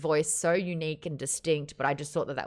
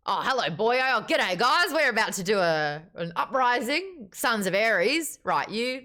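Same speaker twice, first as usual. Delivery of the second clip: hammed-up Australian accent, higher in pitch; Severo, Aussie accent; 4 semitones higher, 4 dB louder than usual. Arguing that a book character sounds Australian, hammed-up Aussie accent. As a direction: play a rowdy Aussie rallying the lads. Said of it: "thinking that Severo's got an Australian accent".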